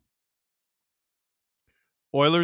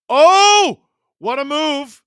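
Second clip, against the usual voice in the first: excited call; 7 semitones higher, 11 dB louder, slower than usual. Real, not acted. Live play-by-play of a slick move.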